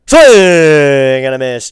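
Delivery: drawn-out strikeout call. Acted. Streamer calling the strikeout to his chat, long and loud. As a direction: stretch it long and loud, broadcaster style.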